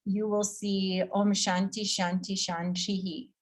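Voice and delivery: Shantihi with an echoed hi on the last vowel; echoing final vowel. Shown as a demonstration. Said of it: "That's like an echo".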